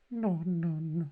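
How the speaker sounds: very high, drawn-out silly voice; hammed-up high-pitched beer drinker; trial character voice. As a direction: plead for beer in squeaky falsetto. Trying out beer quest character voices.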